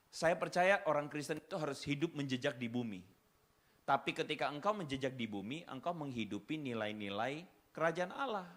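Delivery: ordinary delivery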